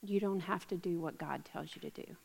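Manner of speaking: soft sly whisper to Eve; whispered temptation. Retold or imitated. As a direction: lean in and whisper the lie. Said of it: "He whispered to her".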